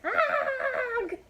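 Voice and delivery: high, loud panicked yelp; startled shriek. Acted. Elephant running from the bird, loud shriek. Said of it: "Means he's shouting".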